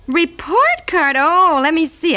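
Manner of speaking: high, excited exclamation; light up with eager interest; delighted surprise. Acted. Mother delighted by report card.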